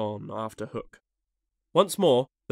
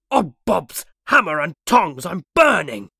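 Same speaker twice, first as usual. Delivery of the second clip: loud, higher outburst of pain and alarm; alarmed yell; 5 semitones higher, 10 dB louder than usual. A narrator's reading. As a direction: cry out as if scorched. Pirate jumps up from hot seat.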